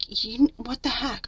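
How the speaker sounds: whispered disbelief at dad; exasperated whisper. Retold or imitated. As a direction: hiss it under your breath in disbelief. Retelling being mad at dad.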